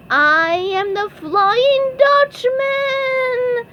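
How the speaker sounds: high, drawn-out would-be spooky yell; SpongeBob yelling. A narrator's reading. SpongeBob drops into the party to scare, high wailing yell. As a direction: stretch it out like a cartoon ghost. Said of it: "yelled SpongeBob".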